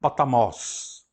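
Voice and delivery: "potamos" slowly, stress on the last syllable; final-syllable stress. Shown as a demonstration. Teacher demonstrating Greek accent, "potamos" stressed last. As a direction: land on the "mos" of "potamos". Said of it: "na última sílaba".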